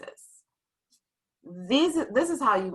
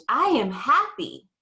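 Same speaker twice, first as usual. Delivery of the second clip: "I am happy" said higher, bursting with a big strong feeling; excited exclamation; 6 semitones higher than usual. Shown as a demonstration. Teacher showing the class an exclamation mark, excited. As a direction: make "I am happy" pop like it ends with an exclamation mark. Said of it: "voice is gonna change".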